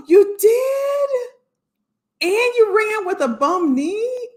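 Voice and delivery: high, drawn-out gasp of surprise; gasp, then stretch it out high in amazement; amazed. Real, not acted. Reacts to viewer's running news.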